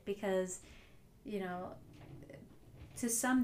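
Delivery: usual speaking voice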